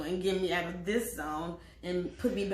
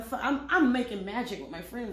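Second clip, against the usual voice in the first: usual speaking voice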